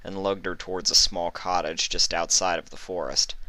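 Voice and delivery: ordinary delivery, nothing marked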